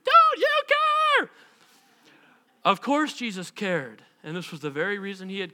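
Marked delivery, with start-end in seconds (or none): 0.0-1.3 piercingly high, loud, stretched-out screech; over-the-top shriek. Shown as a demonstration. Preacher relishes the disciples' word, shrieking it to the limit. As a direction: push the shriek to its hammed-up extreme. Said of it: "I like the word shrieked".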